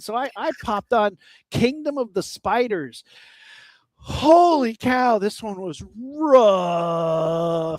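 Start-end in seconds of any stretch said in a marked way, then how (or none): 4.0-7.8 slow, drawn-out groaning complaint; pained dismay. Retold or imitated. Telling co-host how rough the movie was, slow pained drawl. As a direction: stretch it out like it still hurts.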